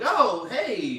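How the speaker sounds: loud, high put-on hello; mock startled greeting. Staff answering unexpected Homelander, mock startled greeting. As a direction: burst out with an exaggerated startled welcome.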